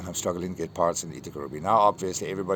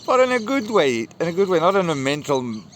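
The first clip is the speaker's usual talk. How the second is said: laughing reassurance; 9 semitones higher, 8 dB louder than usual. Real, not acted. Laughing reassurance after emotional admission.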